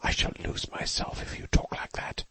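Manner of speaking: tense hissed whisper; whisper through teeth. A narrator's reading. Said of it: "whispering through her teeth".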